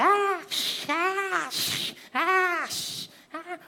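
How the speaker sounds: high breathy gasps, hammed-up; mock panting. Telenovela character worn out from a fight, hammed-up panting. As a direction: pant like a telenovela fighter spent from punching.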